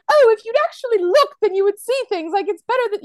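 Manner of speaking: high, loud, play-acted scolding; hammed-up haughty reprimand; mock admonishing. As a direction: imitate a nun telling someone off, pitched well up. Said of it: "she admonishes him in some way".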